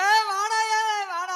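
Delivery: calling out loudly, a hammed-up mourner; loud hammed-up wailing call; loud mock-mourning call. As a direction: bawl for your friend to come.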